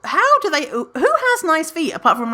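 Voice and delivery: high outraged disbelief; incredulous high rant. Retold or imitated. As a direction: sound baffled and high. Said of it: "And I'm like".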